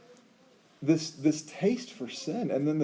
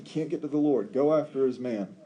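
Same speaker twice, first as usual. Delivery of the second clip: ordinary talk, nothing marked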